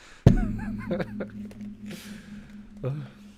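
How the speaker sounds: sudden squeaky giggle way up high; high squealing laugh. Real, not acted. Streamer laughing at her own theory, squealing high laugh. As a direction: let a shrill-pitched laugh burst out.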